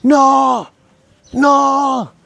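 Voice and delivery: long, high, drawn-out cries of disbelief; imitated shouting. Preacher re-enacting his friend's shouts at the meeting, shouted. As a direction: cry out high and loud, stretching each word. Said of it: "Kevin empieza a gritar".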